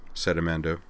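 ordinary delivery, nothing marked

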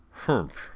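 short falling "humph", kept contained; a small grunt on spotting a mistake; perplexed humph. A narrator's reading. Professor realizing his computational error, humph. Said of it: "The sound he had made was".

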